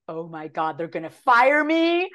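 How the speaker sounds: hammed-up rising wail; mock panic climbing high and loud; retold panic. Actor retelling her fear of being fired, mock panicked wail. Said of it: "I was like".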